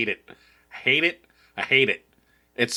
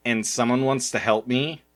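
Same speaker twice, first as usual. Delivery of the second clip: usual speaking voice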